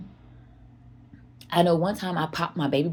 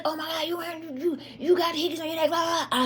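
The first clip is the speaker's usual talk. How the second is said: much higher, shocked and furious; mother snapping at her son over hickeys, much higher; 11 semitones higher than usual. Retold or imitated. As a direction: explode at him in disbelief. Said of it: "so I snapped".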